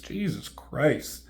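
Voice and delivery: ordinary talk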